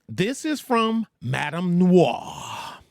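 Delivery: ordinary delivery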